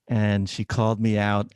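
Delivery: ordinary talk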